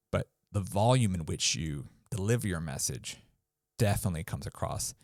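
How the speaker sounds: ordinary delivery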